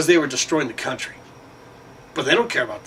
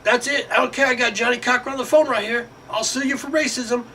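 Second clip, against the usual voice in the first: much higher voice, hammed-up demand; mock threatening applicant; 10 semitones higher than usual. Applicant threatening to sue the company, high hammed-up voice. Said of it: "they would show up and say".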